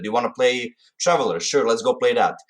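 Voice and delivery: ordinary talk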